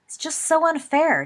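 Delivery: high, loud protest; indignant declaration. Acted. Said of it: "James declared".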